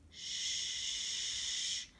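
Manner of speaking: long hushing sh; slow exaggerated speech modeling. Shown as a demonstration. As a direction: hiss out a quiet sh. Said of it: "like be quiet".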